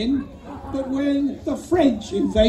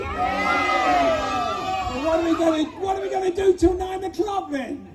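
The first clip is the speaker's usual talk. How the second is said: mock outraged shout; 7 semitones higher than usual. Host teasing the silent crowd, mock shouting. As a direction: throw it out to the audience like a panto host.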